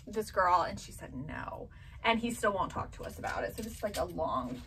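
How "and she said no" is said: hushed. Real, not acted. Son's rejection told quietly.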